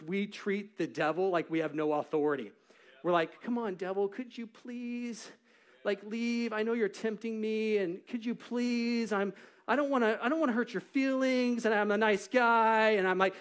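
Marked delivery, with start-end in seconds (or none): 3.4-12.9 mock timid pleading. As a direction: beg the devil apologetically, hammed-up. Mocking timid plea to devil.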